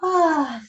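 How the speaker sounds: an audible open sigh of release; demo sigh. Shown as a demonstration. Yoga teacher showing the class a releasing sigh. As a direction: let out a long relieved sigh. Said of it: "maybe in a nice, ah, sigh".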